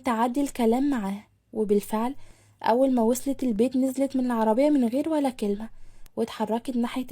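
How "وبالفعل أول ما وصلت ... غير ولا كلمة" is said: plain reading voice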